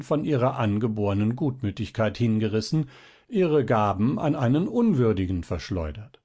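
ordinary delivery

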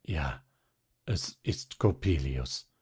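father resigned to his fate; weak, broken voice. Acted. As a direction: admit defeat with a broken voice. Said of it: "mit matter, gebrochener Stimme".

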